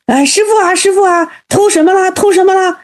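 high, agitated storyteller's voice; mock alarm, pitched well up; clamouring monks. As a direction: act out monks anxiously asking their master. Monks flock in, alarmed by thief.

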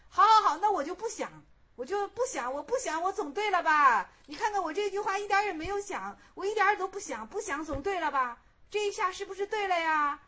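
high-pitched, insistent self-justification; protesting. Acted. Monk insisting he is not thinking, protesting. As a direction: argue back eagerly, pushing each point.

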